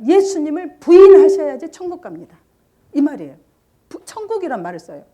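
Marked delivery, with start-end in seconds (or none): 0.1-2.4 much louder, higher, each word hammered out; emphatic quote. Retold or imitated. Teller hammering the deceivers' exact words, much louder and higher. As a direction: drive the false words home. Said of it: "딱 그 말이에요".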